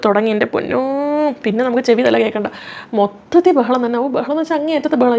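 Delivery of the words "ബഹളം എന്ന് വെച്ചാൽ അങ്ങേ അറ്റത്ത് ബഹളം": usual speaking voice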